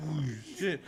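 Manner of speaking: laughing through it. Real, not acted. Laughing at the retold moment.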